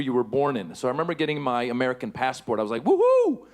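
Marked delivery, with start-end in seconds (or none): 2.8-3.4 high, loud celebratory woohoo; excited whoop. Retold or imitated. Comic getting his American passport, retold joyful whoop. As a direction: whoop it out with glee.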